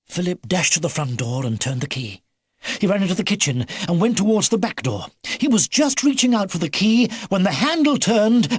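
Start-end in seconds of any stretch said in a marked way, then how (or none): none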